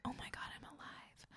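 whispered amazement at being alive; barely audible, hushed in wonder; awestruck whisper. Acted. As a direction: whisper it like a stunning discovery. Awestruck realization acted out.

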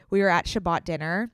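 usual speaking voice

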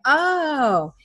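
long "oh", pitched high then sliding down; retold dawning realization. Coach retells boy grasping the baseball analogy, dawning realization. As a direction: imitate a kid suddenly getting it. Retold child suddenly understands.

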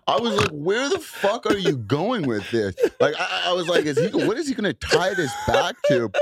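high-pitched laughing disbelief. Real, not acted. Host laughing at the storyteller's winding tangent. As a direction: laugh through it, incredulous.

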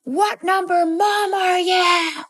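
high-pitched, slow mock demand; hammed-up drawn-out wail, every word stretched; insistent eerie child. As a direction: imitate a possessed toddler insisting on an answer. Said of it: "I said it again".

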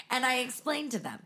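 ordinary talk, nothing marked

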